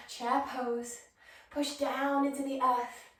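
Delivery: normal talking voice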